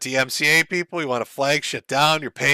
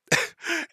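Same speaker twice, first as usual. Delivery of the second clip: high laugh; 5 dB quieter than usual. Real, not acted. Laughing at Ralph's reply.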